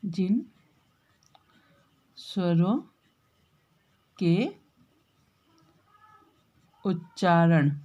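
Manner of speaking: spaced-out dictation pace; slow dictation. Shown as a demonstration. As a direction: dictate each word slowly while writing. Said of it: "लिख देती हूँ".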